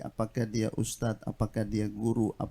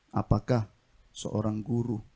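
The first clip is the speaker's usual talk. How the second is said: normal talking voice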